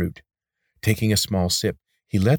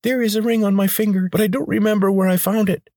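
high, louder squeak, panicky; squeaking like a trapped mouse; 5 dB louder than usual. A narrator's reading. Healer squeaking nervously at the Rakash leader. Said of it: "The nasal voice squeaked like a mouse caught in a trap".

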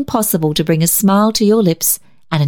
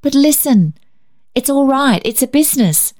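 urging; 8 semitones higher than usual. A narrator's reading. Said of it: "urged the little cripple".

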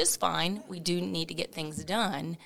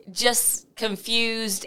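ordinary talk

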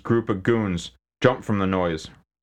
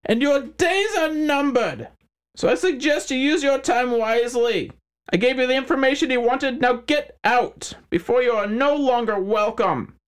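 smug menacing crime boss; 3 dB louder than usual. Acted. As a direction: threaten them with a gloating smile. McHines threatens.

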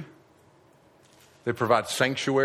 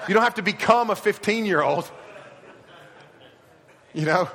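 laughing; 6 semitones higher than usual. Real, not acted. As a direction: laugh as you tease them. Laughs at teens joke.

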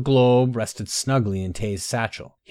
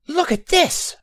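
put-on very high-pitched boyish call; excited cry; 4 dB louder, slower than usual. A narrator's reading. Boy calling companions to see his treasure, excited high cry. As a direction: cry out high over a thrilling find. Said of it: "Vaster cried".

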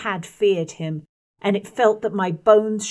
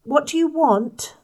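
awkward diffidence; 8 semitones higher than usual. Acted. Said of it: "I asked with awkward diffidence".

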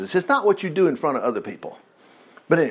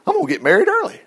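high, wide-eyed daydreaming; David's excited thoughts; 9 semitones higher than usual. Acted. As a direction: think aloud like a thrilled kid. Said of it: "is thinking".

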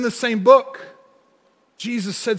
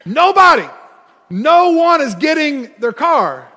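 hammed-up shouted paraphrase; 6 semitones higher, 5 dB louder than usual. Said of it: "What this guy was saying is".

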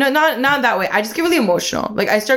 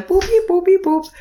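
calling cutely; 10 semitones higher than usual. Retold or imitated. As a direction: coo it like calling a pet. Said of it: "she's kinda calling".

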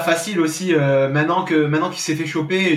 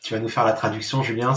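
ordinary talk, nothing marked

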